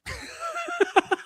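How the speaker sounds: short, high-pitched laugh; high giggle. Real, not acted. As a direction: giggle high in the middle of the rant. Laughing mid-rant.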